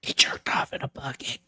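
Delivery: unvoiced whisper, a quick dirty aside. Real, not acted. Host whispering a crude joke to co-host, whispered. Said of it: "whispered aside".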